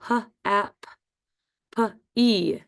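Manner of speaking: "h, a, p, p, e" as separate sounds ending on long E; sounded out. Shown as a demonstration. Teacher spelling happy for first graders, sounded out. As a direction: sound out h, a, p, p, e piece by piece. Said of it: "sounds like the long E".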